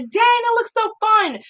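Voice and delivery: high-pitched retold wistful thrill; mock envious excitement. Bag holder watching people get on rides, playfully envious. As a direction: pitch it way up, admiring from the sidelines.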